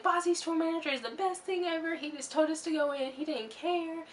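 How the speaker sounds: high, put-on gushing voice. Fan praising the tour manager, high and gushing. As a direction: gush about him up high. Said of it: "but then I was like".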